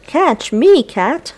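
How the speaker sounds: mouse voice. Acted. Mouse character voiced in story.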